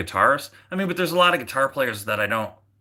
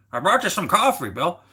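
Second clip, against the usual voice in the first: higher, swooping mock voice for a relative; playful caricature, pitched up; put-on brother-in-law voice; 7 semitones higher, 3 dB louder than usual. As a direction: put on a silly raised voice to imitate your brother-in-law. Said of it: "I like making different voices for people".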